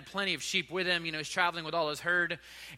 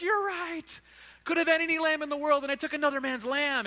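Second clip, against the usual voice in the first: much higher, strained repentant wail; anguished confession; 8 semitones higher than usual. Acted. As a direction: cry it out in remorse. David repents, tearing his clothes.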